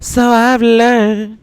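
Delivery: a comedian mimicking the singer, very high and slow. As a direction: float it up high and slow like a dreamy pop star. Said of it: "When he did the [name] thing".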